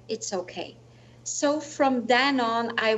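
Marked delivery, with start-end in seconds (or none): 0.1-0.8 soft, low murmured reassurance; quiet comfort. Retold or imitated. Recalling the inner voice of faith, softly calm. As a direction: breathe it out like a settled faith. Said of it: "deep feeling of comfort and faith".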